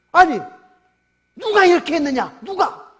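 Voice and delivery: high, insistent questioning; astonished demand. Acted. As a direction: demand to know who did it. Crowd demands who did it.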